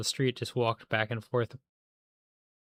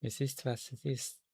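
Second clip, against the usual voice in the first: soft, deadpan line in a mock German accent; quiet German accent; 6 dB quieter than usual. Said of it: "I'm doing a German accent very stereotypically".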